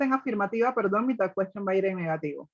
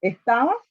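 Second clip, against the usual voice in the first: "Estabas" climbing at the end; rising question intonation; 4 dB louder than usual. Shown as a demonstration. Teacher translates the tag for students, rising question intonation. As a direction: send "Estabas" upward, as if checking. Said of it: "ya la entonación sube".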